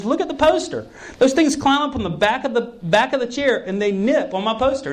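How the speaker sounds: high, animated retelling; excited friend. Retold or imitated. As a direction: voice the friend, worked up and pitched high.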